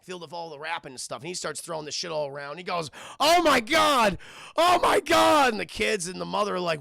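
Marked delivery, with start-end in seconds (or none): 3.2-5.5 loud, high, drawn-out exclamations; hammed-up ecstatic yelling; overjoyed shouting. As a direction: imitate a dad thrilled by his prize. Dad unpacks his prize.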